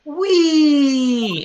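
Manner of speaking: high squeaky wee; playful squeal. Acted. Player squeals for fun, high.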